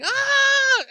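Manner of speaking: loud, very high scream; piercing mock scream; imitated scream. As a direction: shriek like a terrified wife. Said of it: "she's screaming".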